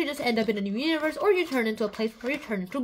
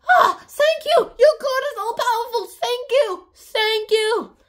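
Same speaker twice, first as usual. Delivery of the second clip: much higher, shouted thanks; mock gushing thanks; 8 semitones higher, 6 dB louder than usual. Locals thanking Columbus, shouted mock gratitude. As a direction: gush with relief. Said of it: "they're all like".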